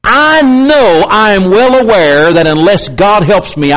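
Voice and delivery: ordinary talk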